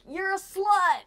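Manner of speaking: high, loud mock yell; heckler shout. Imitating a guy yelling at her after a show. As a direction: shout the insult like a passing jerk. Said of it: "some guy being like".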